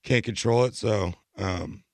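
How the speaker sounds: ordinary delivery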